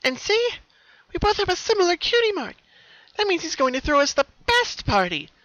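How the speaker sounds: very high, loud, eager; very excited. Acted. Pinky showing her sister the cutie mark, excited. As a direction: point it out bursting with glee. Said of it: "Pinkamena was very excited".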